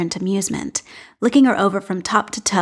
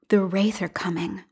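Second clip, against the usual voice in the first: unvoiced whisper, quiet and softer; whispered echo; 5 dB quieter than usual. A narrator's reading. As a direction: breathe it like an echo inside her head. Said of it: "The phrase resonates in her mind".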